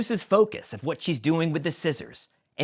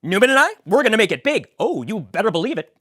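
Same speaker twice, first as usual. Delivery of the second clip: Kramer voice; 8 semitones higher, 8 dB louder, faster than usual. Acted. Kramer boasting.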